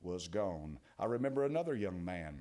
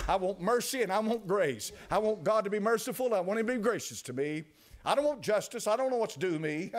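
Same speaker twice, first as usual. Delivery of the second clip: higher and louder, swelling cries for grace; full-force preaching; 5 semitones higher, 5 dB louder than usual. Acted. Preacher to congregation crying out for God's grace, fervent and loud. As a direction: pour it out like a revival preacher pleading for mercy.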